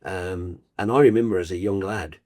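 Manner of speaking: normal talking voice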